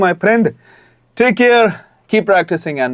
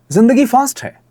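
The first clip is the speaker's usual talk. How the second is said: higher, punchy energetic line read; fast touch; 8 semitones higher than usual. Shown as a demonstration. Coach demonstrating the ad's opening line, fast touch. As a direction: give the words a lively, quick lift. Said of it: "वो fast वाला touch लेके आओ".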